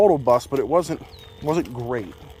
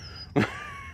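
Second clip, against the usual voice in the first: squeaky high laugh; 8 dB quieter than usual. Real, not acted. Laughs at lost footage.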